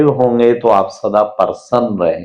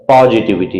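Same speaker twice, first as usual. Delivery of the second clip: usual speaking voice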